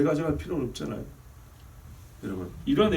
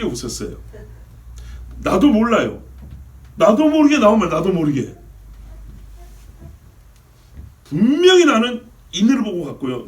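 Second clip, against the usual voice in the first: loud, high, impassioned sermon telling; preaching at full force; 8 semitones higher, 8 dB louder than usual. Acted. Pastor tells his congregation the parking story, preaching loud.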